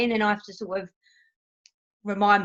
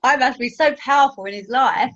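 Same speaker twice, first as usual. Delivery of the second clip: ordinary talk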